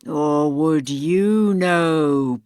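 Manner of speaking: low, slow, eerie ghostly voice; hollow ghost. Acted. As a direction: intone like a dead man's spirit.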